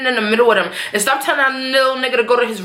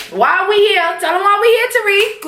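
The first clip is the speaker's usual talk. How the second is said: mock Tasha; 9 semitones higher than usual. Tasha confronting Tariq in story.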